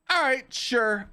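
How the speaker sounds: high-pitched, hammed-up acceptance; mock reluctant agreement. Acting out his reluctant acceptance.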